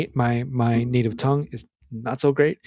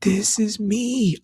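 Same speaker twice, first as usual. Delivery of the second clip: pleading son; 8 semitones higher, much slower than usual. Retold or imitated. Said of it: "And Nick goes".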